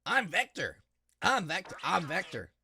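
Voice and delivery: a hint of a gruff crocodile voice; lightly voiced tough guy; Vector voice. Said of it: "Can I do a Vector voice?".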